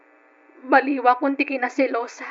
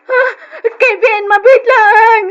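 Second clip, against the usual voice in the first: crying plea; 7 semitones higher, 9 dB louder than usual. Acted. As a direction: beg him to stay through tears. Begging Kevin not to leave. (umiiyak na pagmamakaawa)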